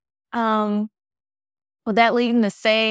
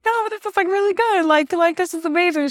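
much higher, squeaky voice for her past self; squeaky retold reassurance; 10 semitones higher than usual. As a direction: squeak it out, choked with emotion. Retold tearful goodbye to roommate.

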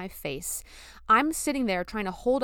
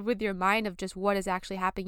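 normal talking voice

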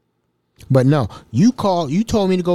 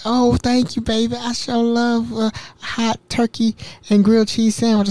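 put-on sweet old lady; 9 semitones higher than usual. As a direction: play the sweet grateful grandma. Old resident thanking him sweetly.

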